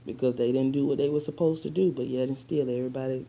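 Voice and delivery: ordinary delivery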